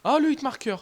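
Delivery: a burst of glee at the hit; excited shout. Real, not acted. Gamer yelling at his screen over a hitmarker, excited high shout.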